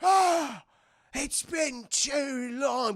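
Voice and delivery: high, drawn-out mock reverence; hammed-up emotional reunion; awed pirate rat. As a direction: greet a long-lost captain in wonder. Pirate rat greets returned captain.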